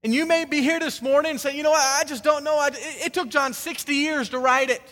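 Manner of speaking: impassioned preaching shout. Real, not acted. Preacher answering this morning's doubters, impassioned shout. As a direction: cry it out to the whole congregation.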